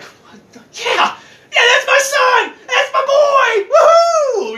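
high, loud whooping cheer; hammed-up bragging dad; proud Joseph. Joseph bragging about his famous son, whooping high. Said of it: "this guy probably was just like".

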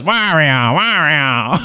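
nasal creature chant of its own name; Wario's name call. Acted. Freshly evolved Wario calling his own name to the party. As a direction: announce yourself like a newborn monster. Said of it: "And he, and he goes".

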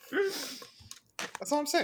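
"That's all I'm saying." sung out high and slow, rising at the end; drawn-out singsong. Shown as a demonstration.

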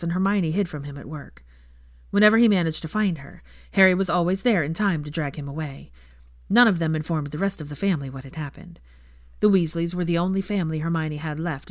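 ordinary delivery, nothing marked

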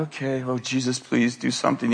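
soft, low aside to God; a quietly pleading little prayer; retold nervous prayer. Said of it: "I'm a little nervous".

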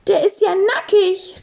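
hoher kindlicher Ausruf; hoch und empört-belustigt herausrufen. A narrator's reading. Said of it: "rief Polly". (hohes Rufen, kaum lauter)

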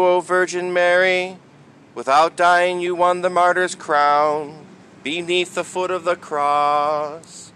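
ordinary talk

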